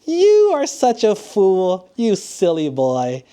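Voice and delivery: hammed-up royal laugh into slow, high mockery; mock the boy with a big acted laugh, settling down at the end; scornful laughing king, drawn out. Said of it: "And the king laughs".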